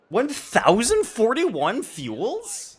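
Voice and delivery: high-pitched, drawn-out disbelief; incredulous. Real, not acted. Streamer reacting to a viewer's fuel count, incredulous. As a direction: question it slowly in high-pitched amazement.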